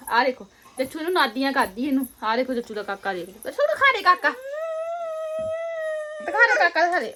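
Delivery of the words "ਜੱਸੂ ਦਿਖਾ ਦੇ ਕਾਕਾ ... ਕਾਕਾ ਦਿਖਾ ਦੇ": excited coaxing. Real, not acted. Coaxing toddler to show doll.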